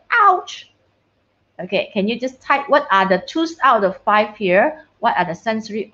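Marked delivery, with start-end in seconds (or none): 0.1-0.7 high sudden ouch, a teacher acting it out; boy stung by a bee, mock shouted ouch. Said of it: "and then he shouted".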